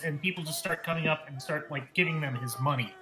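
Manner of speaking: ordinary talk, nothing marked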